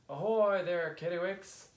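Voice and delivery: high, loud sailor's hail. A narrator's reading. Fisherman Finn hails the kittiwakes from his boat, hailing. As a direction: call across the water to friends.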